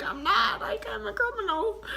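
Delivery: high, quavering voice; teary. Retold or imitated. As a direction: insist through tears. Tearful self-blame.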